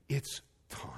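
soft, unvoiced whisper; imitated hushed words; calm whisper. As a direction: whisper it as a quiet announcement. Wife's hushed words retold.

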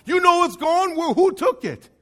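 high-pitched indignant questioning; acted alarm, demanding an answer; distraught disbelief. Voicing upset Mary, arguing back.

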